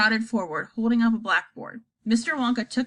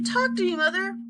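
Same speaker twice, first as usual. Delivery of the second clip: anxious; 9 semitones higher than usual. Acted. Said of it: "said Missus Bucket anxiously".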